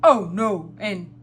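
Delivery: loud storybook Santa with ho-ho-ho; Santa Claus. A narrator's reading. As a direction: boom it out like Father Christmas.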